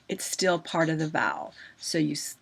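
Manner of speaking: usual speaking voice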